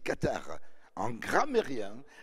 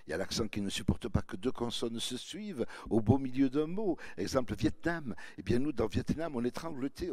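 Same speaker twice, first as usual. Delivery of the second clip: ordinary talk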